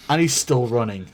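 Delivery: usual speaking voice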